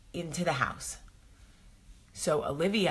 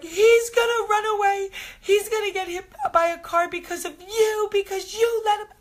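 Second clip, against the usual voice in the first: put-on much higher, louder wailing child's voice; imitating hysterical crying; 8 dB louder than usual. Mom retelling her daughter crying over the open gate, high wailing. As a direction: wail it like a panicked little girl. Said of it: "like, freaking out".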